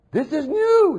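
very high, loud novelty-crazed squeal; hammed-up gushing, stretching the final "new" into a long cry; mock giddy excitement. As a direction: parody an overexcited crowd. Mocking crowds craving anything new.

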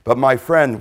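ordinary talk, nothing marked

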